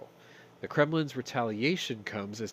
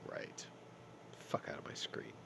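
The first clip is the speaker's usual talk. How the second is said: whisper; 8 semitones lower, 15 dB quieter than usual. Real, not acted. Cursing the face on screen.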